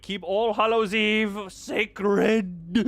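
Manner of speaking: mock proclamation. Player defending the holiday, rising mock-solemn cry. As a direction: proclaim it with hammed-up fervour.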